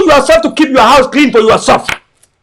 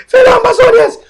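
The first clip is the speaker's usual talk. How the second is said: ordinary delivery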